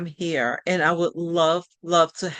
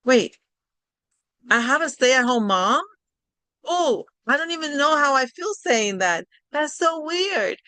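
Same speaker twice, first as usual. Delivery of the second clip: higher, puzzled and amused, slowing at the end; surprised daughter; 6 semitones higher than usual. Retold or imitated. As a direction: daughter Crystal realizing she has a stay-at-home mom, surprised. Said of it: "my daughter, goes".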